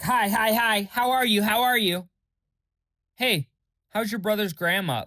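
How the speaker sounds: high, loud mimicry of an overeager friend; mock chirpy pestering. Mimicking a friend's meaningless questions, mock eager. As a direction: imitate someone peppering you with empty greetings.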